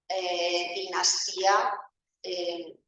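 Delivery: ordinary talk, nothing marked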